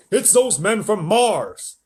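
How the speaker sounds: high, loud, drawn-out accusation; blustering army chief. A narrator's reading. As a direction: bluster slowly as a military man.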